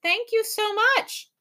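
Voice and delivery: high, slow, polite thanks; politely. A narrator's reading. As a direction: answer the insult sweetly. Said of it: "Tom managed to say politely".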